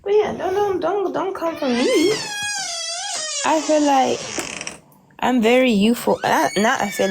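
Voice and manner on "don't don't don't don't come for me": ordinary talk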